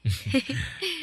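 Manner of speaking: a quick, light amused laugh; short laugh. Acted. Young master laughing at the maid's forgetfulness, light laugh. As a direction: laugh it off, teasing. Tutor teases forgetful student.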